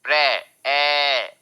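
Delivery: "प्रे" held long, then its vowel "ए" alone; drawn-out vowel sound. Shown as a demonstration. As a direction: isolate and stretch the "ए" in "प्रे". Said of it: "ए sound आ रहा है".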